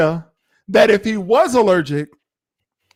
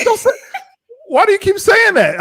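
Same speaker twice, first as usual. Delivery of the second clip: high, loud exasperated cry through a laugh; 14 semitones higher, 4 dB louder than usual. Retold or imitated. Host exasperated at husband's phrasing, high loud laughing cry. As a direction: throw up your hands, why keep saying it.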